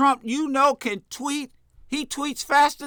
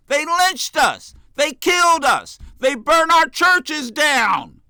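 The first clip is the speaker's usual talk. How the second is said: loud, raised-voice indictment; real, forceful anger at an injustice; impassioned outrage; 3 semitones higher, 4 dB louder than usual. Real, not acted. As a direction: hammer each wrong home with rising force. Impassioned recounting of voter terror.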